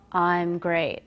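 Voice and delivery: mock unenthused "great"; tone not matching words. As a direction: imitate a client whose tone contradicts the cheerful word. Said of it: "the tone is not working".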